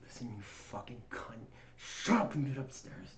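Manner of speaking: low menacing growl. Retold or imitated. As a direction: lean in and growl it low and mean. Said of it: "He leans over and he goes".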